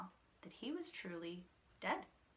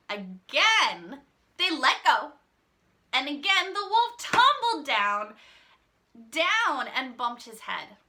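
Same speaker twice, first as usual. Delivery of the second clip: dramatic fall; 4 semitones higher, 12 dB louder than usual. A narrator's reading.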